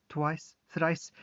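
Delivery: ordinary delivery, nothing marked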